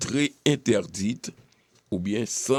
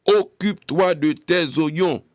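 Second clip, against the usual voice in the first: raised, higher, louder put-down; scolding husband; 7 semitones higher, 6 dB louder than usual. Retold or imitated. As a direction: snap it like a man scolding his wife. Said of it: "d'entendre les hommes dire aux femmes". (homme qui rabroue sa femme, plus haut et plus fort)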